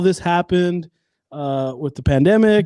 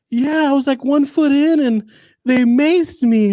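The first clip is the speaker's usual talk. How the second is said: high-pitched, plaintive mock-tearful voice; mock crying lady; 9 semitones higher than usual. Crying lady answering the interviewer, mock tearful. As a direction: imitate a whiny tearful account, drawn out at the end. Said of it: "she's crying".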